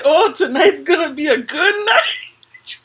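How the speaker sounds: slow, loud, laughing mock line; grandma's promise imitated with a laugh; imitating nana laughing. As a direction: drag it out while laughing. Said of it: "She would say".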